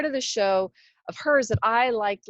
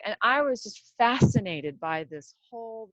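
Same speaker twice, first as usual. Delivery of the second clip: ordinary delivery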